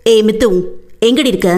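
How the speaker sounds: plain reading voice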